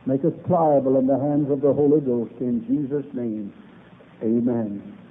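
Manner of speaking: low, hushed prayer voice. Acted. Opening prayer before sermon.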